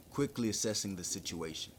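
usual speaking voice

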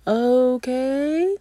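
high, puzzled okay; a baffled little okay; mock-confused okay; unsure okay. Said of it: "And he's like".

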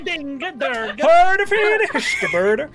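loud, high, stretched-out invented words; weird giant tongue. Acted. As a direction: call out slowly in made-up giant language. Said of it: "it's this weird kind of".

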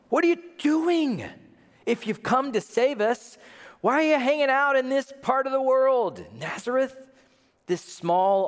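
high, exasperated urging; impatient pleading. Acted. Impatient believer urging young Jesus to act, exasperated.

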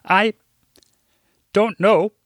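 put-on mildly choked-up older woman; understated teary voice; muffled tears. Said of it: "in between muffled tears".

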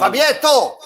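loud call into a cave. Acted. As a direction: shout the name into the void. Calling out into the echo. (chiamare Fabietto gridando nell'antro)

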